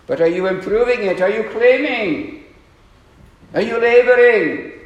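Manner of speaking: rising pitch and volume, question after question; building challenge. Real, not acted. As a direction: press them harder with each question, to a near shout. Preacher presses the congregation.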